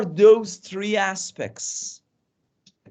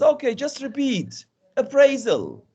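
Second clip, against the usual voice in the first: higher, lightly acted drilling teacher; 7 semitones higher than usual. Teacher brushing off a student's question, lightly acted drilling voice. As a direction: model the word for the class, pitched up. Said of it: "And a teacher says".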